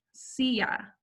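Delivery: Silla said SEE-ya, whispered; Spanish silla. Shown as a demonstration. Teacher modelling the word, whispered silla. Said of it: "that's how you say chair in Spanish".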